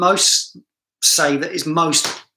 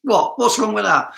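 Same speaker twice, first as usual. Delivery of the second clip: indignant protest; 4 semitones higher than usual. Real, not acted. Protests at being laughed at.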